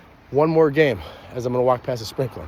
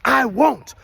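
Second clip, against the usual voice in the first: hyped demo line; 9 semitones higher, 5 dB louder than usual. Shown as a demonstration. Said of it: "look at your partner in the eyes, like".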